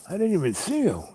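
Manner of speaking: high, loud exclamation; imitated startled driver. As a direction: act out the driver's shock. Snowplow driver exclaiming after the crash.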